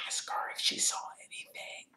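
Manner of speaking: very soft, secret whisper; barely voiced whisper. A narrator's reading. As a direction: mouth it with almost no sound. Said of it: "Harry mouthed at Hermione".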